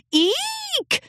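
short high eek scream. Acted. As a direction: squeal in fright. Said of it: "Another scream rang out".

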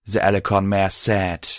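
ordinary delivery

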